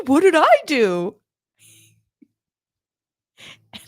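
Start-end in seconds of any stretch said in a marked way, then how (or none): 0.1-1.2 high, louder protest; indignant surprise. Retold or imitated. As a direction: caught off guard and offended. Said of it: "I was like".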